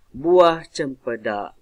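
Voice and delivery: slow, loud "Buah cempedak", halting after "cempedak"; pause after cempedak. Shown as a demonstration. Teacher showing pupils the mid-line break, pause after "cempedak". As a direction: stretch "Buah cempedak" out, then stop dead. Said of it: "Dia akan berhenti".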